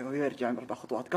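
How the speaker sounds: ordinary talk, nothing marked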